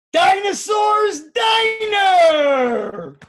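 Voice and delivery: slow, high, loud showman announcement; big announcing shout. Shown as a demonstration. Teacher announcing the poem title to the kids, drawn out and booming. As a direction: stretch out the title like a circus barker. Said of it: "ready or not".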